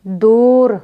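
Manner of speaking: दूर with a long stretched oo; drawn-out maroof vowel. Shown as a demonstration. Teacher showing maroof, दूर drawn out. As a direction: hold the oo in दूर. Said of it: "इसको खींच कर पढ़ा जाएगा".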